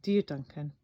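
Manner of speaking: ordinary delivery, nothing marked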